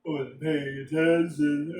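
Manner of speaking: talking through a yawn; slow, stretched words inside a yawn. Real, not acted. Tired player excusing a missed turn, talking through a yawn. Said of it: "Oh, excuse me. I don't know why I'm so tired".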